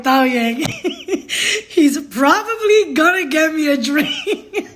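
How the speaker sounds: real helpless laughter breaking up the words; dying laughing. Real, not acted. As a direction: struggle to talk through the giggles. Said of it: "had to calm myself 'cause I was dying".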